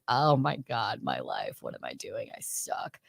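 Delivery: despair. Retold or imitated. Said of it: "you're like".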